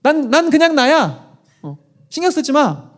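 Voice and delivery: mock a young woman waving off her mother; dismissive sister-in-law. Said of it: "우리 많이 하는 얘기죠?".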